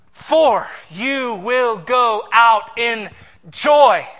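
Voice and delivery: slow, building loud declaration; emphatic proclamation. Acted. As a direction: stretch it out and swell to a loud joy. Re-reading the promise with force.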